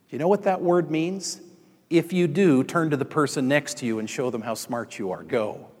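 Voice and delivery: usual speaking voice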